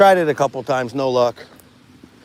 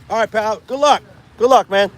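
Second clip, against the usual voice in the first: high, louder friendly send-off; calling out goodbye; 9 semitones higher, 5 dB louder than usual. Real, not acted. Angler wishing another fisherman good luck, calling out. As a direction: call it out to someone walking off. Calls farewell to departing fisherman.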